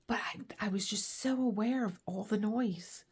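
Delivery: usual speaking voice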